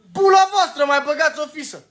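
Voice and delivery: furious yelled curse at the critics; angry curse. Real, not acted. Cursing CNA in anger.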